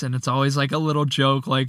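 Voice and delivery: ordinary delivery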